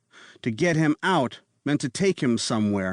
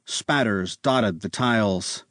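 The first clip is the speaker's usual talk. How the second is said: ordinary delivery, nothing marked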